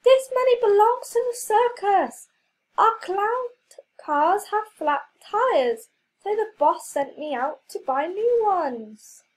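high, loud indignant clown. Acted. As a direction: protest like an offended clown.

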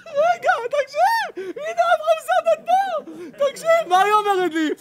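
high, loud, drawn-out excited call. Real, not acted. Preacher to audience, high excited drawn-out call. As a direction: hold the crowd with rising excitement.

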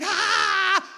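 high, loud shriek of joy; excited scream. Pretend. Pastor imagining lightning strike, joyful scream. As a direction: scream out in sheer delight. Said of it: "I would literally just scream".

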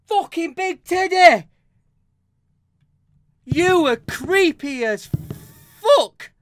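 high, loud scared shouting; real scared swearing at the teddy; frightened yell. Real, not acted. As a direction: yell it out still shaken by the fright. Reacting to creepy teddy.

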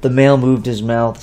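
ordinary delivery

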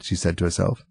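usual speaking voice

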